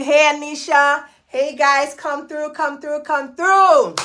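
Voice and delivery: usual speaking voice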